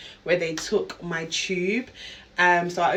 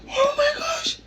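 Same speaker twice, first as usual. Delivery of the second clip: squealed far higher in real shock; shocked shriek; 4 dB louder, slower than usual. Real, not acted. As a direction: shriek at the result. Sees test result.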